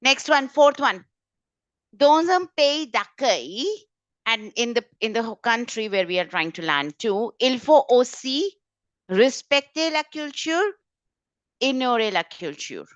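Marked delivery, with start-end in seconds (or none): none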